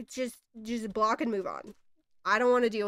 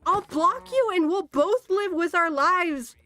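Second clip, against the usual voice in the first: higher, slow and fed up; fed-up higher-pitched lecture; exasperated rant; 5 semitones higher than usual. Real, not acted. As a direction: spell it out to someone who won't get it. Ranting at incest shippers.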